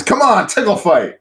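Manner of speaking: high, loud playful urging; imitated eager coaxing. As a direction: egg them on like a brat. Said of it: "She goes".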